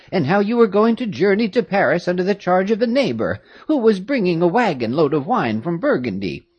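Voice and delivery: put-on a doting old servant, amazed; old woman greeting the girls; old nurse. A narrator's reading. Old nurse Louise voiced.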